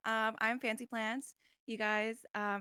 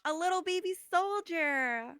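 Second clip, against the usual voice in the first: cooing; 8 semitones higher, 6 dB louder than usual. Real, not acted. Cooing over a new recruit.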